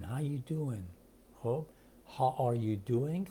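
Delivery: normal talking voice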